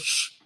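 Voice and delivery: loud hushing sound; sharp shush. Acted. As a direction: cut them off with a shush. Jeff silences the tabloid.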